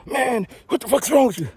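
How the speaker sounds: high exasperated yell at the phone; mock furious caller; imitating angry customer. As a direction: blurt out in fed-up frustration. Frustrated customer yelling at service.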